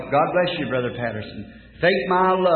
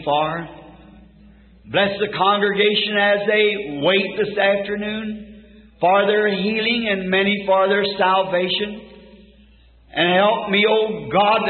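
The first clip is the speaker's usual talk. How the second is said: raised, ringing prayer in measured phrases; fervent prayer; 3 semitones higher than usual. Real, not acted. Preacher praying over his congregation for revival, fervent prayer. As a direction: plead with God, pausing after each petition.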